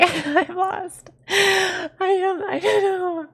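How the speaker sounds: high squealing laugh through the words; real giggling fit, voice shot way up; helpless laughter. Real, not acted. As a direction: wail it while cracking up, lost.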